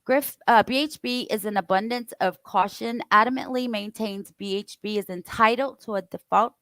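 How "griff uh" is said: ordinary talk, nothing marked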